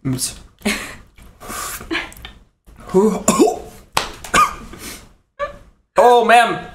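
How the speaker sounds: talking through a burning mouth; words round a stinging tongue; with a sore tongue. Real, not acted. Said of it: "The right side of my tongue is numb".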